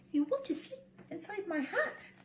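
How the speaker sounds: high, soft horrified pig; horrified squeal. Acted. Pig shocked by the mouse's wish, high squeal. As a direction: squeal in disbelief. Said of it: "squealed Hamilton, horrified".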